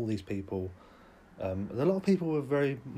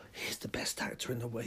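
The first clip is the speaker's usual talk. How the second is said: whispered mock awe; unvoiced, hushed gushing; 5 dB quieter than usual. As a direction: breathe it like a secret.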